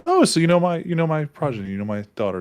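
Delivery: high start settling down; mild surprise. Acted. Prince hears Eddie knows his daughter, mildly surprised. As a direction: react with a lifted, then lowering voice.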